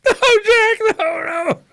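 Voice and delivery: high, loud mock shriek; hammed-up terrified wailing; panicked scream. Imitates wife on horrifying drive.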